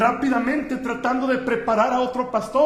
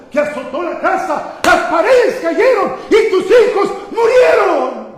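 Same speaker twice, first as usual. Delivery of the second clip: shouted disaster report; 6 semitones higher, 12 dB louder than usual. Retold or imitated. Preacher voices the servant telling Job his children died, shouting. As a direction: build to a loud, high shout.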